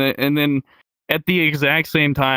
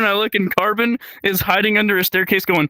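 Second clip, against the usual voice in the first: normal talking voice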